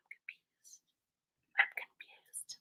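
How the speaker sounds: breathy whisper of mock confusion; whispered confused. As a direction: whisper it, playing dumb. Feigning confusion at his question.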